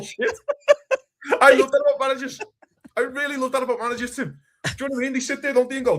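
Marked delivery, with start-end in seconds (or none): 0.0-4.4 laughing through the words. Real, not acted. Pundit laughing at his own manager rant, real laughter. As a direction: crack up mid-sentence.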